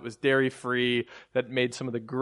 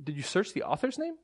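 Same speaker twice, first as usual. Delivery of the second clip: higher-pitched, softer testy question; sharp; 7 semitones higher, 5 dB quieter than usual. Retold or imitated. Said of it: "I sharply said".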